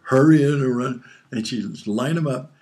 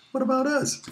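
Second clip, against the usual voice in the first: put-on high squeaky kid voice, pitched way up; whiny kid protest. Boy imitating his brothers, a squeaky whiny protest. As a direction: whine it like a left-out little kid.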